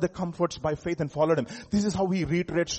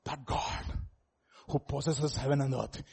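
low, very soft, near-whispered preaching; hushed assurance; 4 semitones lower, 9 dB quieter than usual. Real, not acted. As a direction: bring it right down, reassure them quietly. Hushed assurance after shouting.

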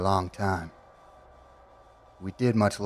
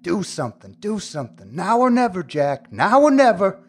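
urgent self-pep talk; 8 semitones higher, 10 dB louder than usual. Acted. Urging himself inside his head.

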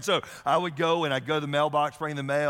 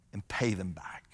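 softer, whispered close; 7 semitones lower, 6 dB quieter than usual. Real, not acted. Pastor finishing the definition, whispering. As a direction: drop to a whisper.

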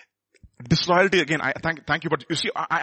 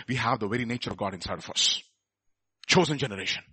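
much softer and lower, intimate; hushed emphasis; 8 semitones lower, 9 dB quieter than usual. Real, not acted. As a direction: lean in and say it softly. Preacher drops voice for truth.

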